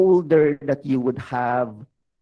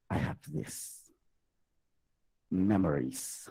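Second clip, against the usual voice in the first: whispered; 6 semitones lower, 9 dB quieter than usual. Retold or imitated. Self-talk, whispered quote.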